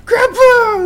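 very high, loud joyful yell; excited shouting. Retold or imitated. Goku seeing his grandpa, high loud joyful yell. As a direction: shriek it like a thrilled kid. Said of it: "he freaks out".

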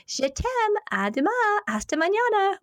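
very high singsong farewell; mother's playful goodnight. Retold or imitated. Mom at bedtime after blowing kisses, playful singsong. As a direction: trill the foreign phrases sweetly, up high. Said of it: "then she'd say".

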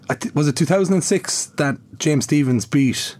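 normal talking voice

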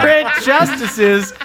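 announcer voice. Pretend. Podcast intro, hosts announced.

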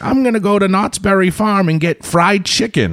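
put-on high, frail old lady voice; granny voice. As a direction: imitate a little old lady. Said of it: "granny voice".

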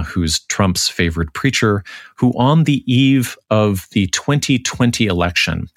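ordinary talk, nothing marked

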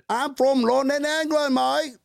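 high, hammed-up British accent. Shown as a demonstration. Host claiming to be from London, fake British accent. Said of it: "what my British accent is".

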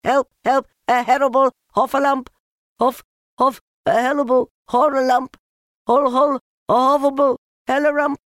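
high, loud, tongue-tangled squealing; crying out in panic. A narrator's reading. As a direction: shriek it fast, tripping over the words in terror. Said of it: "still crying out".

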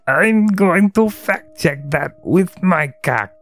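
slow, drawn-out lecherous goblin; goblin character voice. Acted. Goblin taunting his captive, slow put-on creature voice. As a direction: leer it out slowly as a goblin. Goblin figure's line read aloud.

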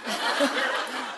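soft unvoiced chuckle; a quick breathy laugh; airy laugh at the punchline. Real, not acted. Laughs at his own line.

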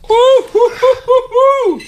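laughing whoops, high and loud; excited whooping. Real, not acted. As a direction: cheer yourself on with a laugh. Hot wing challenge cheering.

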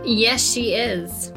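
ordinary talk

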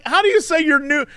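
high, quick disbelief; can't believe she said new; mock incredulous. Retold incredulous thought.

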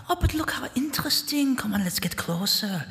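high, soft, fascinated spirit; high-pitched whispery temptation; eerie curious voice. Acted. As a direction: coax in a high, light voice from the dark. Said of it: "voices echoing from the darkness".

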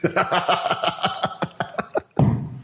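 voiceless, rattling laughter; helpless wheeze after your own jab; long wheezing laugh. Real, not acted. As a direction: laugh on and on until out of voice.

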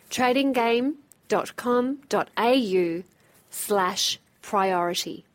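ordinary delivery

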